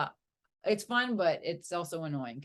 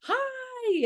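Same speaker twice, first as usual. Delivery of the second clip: bright high excited hi; enthusiastic greeting; 5 dB louder than usual. Retold or imitated. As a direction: chirp it, eager to please. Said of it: "really enthusiastic".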